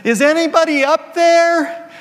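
high, loud panicked call upward; desperate call for help. Acted. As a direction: cry out to the sky, clinging on. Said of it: "and he's hanging on for dear life".

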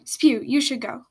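usual speaking voice

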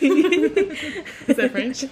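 laughing question; giggling as she asks. Real, not acted.

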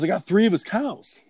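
high-pitched retold boast; acted excited reply. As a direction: deliver the punchline as a mock triumphant call. Acts Billy's proud reply, punchline.

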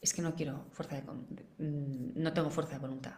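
quiet excuse. Retold or imitated. Someone quitting sport, muttering a resigned excuse. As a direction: mumble a defeated excuse. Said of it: "o que quiere ir a hacer deporte".

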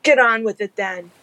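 shouted across the cliff; yelling. Acted. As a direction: yell it over to him. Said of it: "as he yelled over to the man".

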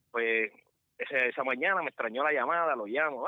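ordinary talk

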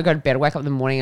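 usual speaking voice

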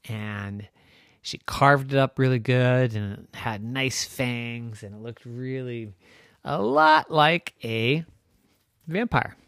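ordinary delivery

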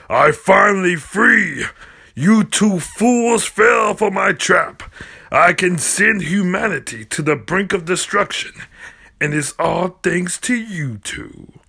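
high, slow triumphant villain shout; gloating villain. Acted. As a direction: crow over the trapped fools. Hamburger reveals his trap.

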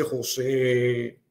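long drawn-out call of the name; imitated powerful voice. A voice calls the grieving husband at the window, imitated powerful call. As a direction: imitate a mighty voice calling, drawing it out. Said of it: "una voz potente, una voz fuerte que me dice".

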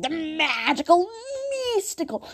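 high-pitched, hammed-up mock wonder; mocking self-imitation. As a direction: parody your own overblown delivery. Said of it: "That's what I sound like. I'm like".

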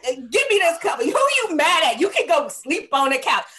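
loud, high mock grumble; imitating a grumpy partner. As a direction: play the cranky bed-mate demanding the covers. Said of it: "You gonna be like".

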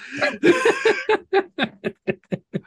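drawn-out laugh, way up high; giggling at yourself; long high laugh. Real, not acted. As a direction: crack up at your own joke. Laughs at own setup joke.

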